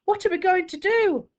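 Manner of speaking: high, loud and desperate; a frightened mother pleading out loud; mother's panicked cry to her husband. Acted. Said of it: "Mama cries".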